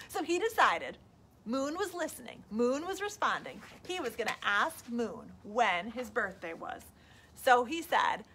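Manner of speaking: ordinary delivery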